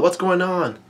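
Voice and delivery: narrated yell, held back; mild mock yell. A narrator's reading. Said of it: "Brule yells out".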